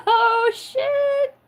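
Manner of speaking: high, loud, laughing exclamation; squealed oh shit. Real, not acted. Reacting to the chat with a high, loud squeal. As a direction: blurt it up high mid-laugh.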